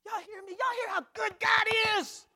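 full-force high holler, stretching the words; roaring praise to the congregation; exultant preacher's shout. Real, not acted. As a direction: shout it high to the whole church.

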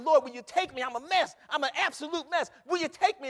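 loud, high preacher's cry; crying out to the Lord; impassioned plea. Acted. Acting out sinner's plea to God.

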